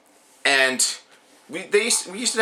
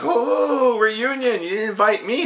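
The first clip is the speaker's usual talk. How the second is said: much higher mocking sneer; sneering Stardust; 10 semitones higher, slower than usual. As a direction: taunt the family in a high put-on voice. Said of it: "Stardust comes like".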